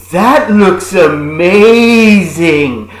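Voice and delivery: mock-amazed wife, hugely drawn out; hammed-up gushing, sliding up high. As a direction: stretch 'amazing' slowly and loudly, overawed. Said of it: "and the wife goes".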